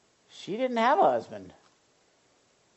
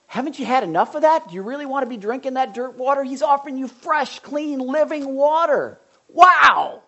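higher and louder, building to a cry of Wow; rising preaching fervor; 8 semitones higher, 8 dB louder than usual. Real, not acted. Preacher urging the congregation, building to a loud, high cry. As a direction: lift the crowd up to that living water. Preaching at full force, climbing.

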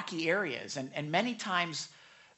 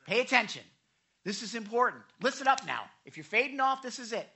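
calling for attention; 4 semitones higher, 7 dB louder, faster than usual. Retold or imitated. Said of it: "sometimes you hear me say".

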